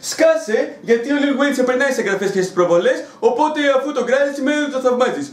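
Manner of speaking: a lightly voiced smug commenter, laughing; mock the know-it-all critic with a laugh. YouTuber mocks a smug commenter, with a light laugh. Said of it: "θα πρέπει να του γράψει από κάτω".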